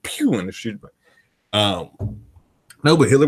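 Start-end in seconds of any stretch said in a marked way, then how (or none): 0.0-0.3 high mouth-made pew; gunshot sound. Retold or imitated. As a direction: imitate a pistol shot. Mimics a gunshot in a story.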